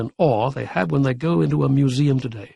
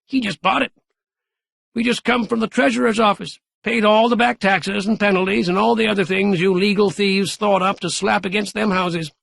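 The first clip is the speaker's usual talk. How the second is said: put-on higher old man's voice, rubbing it in; spry old man crowing; gloating old Gramp; 7 semitones higher than usual. A narrator's reading. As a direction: taunt the mayor as the grandfather. Gramp's voice in the story.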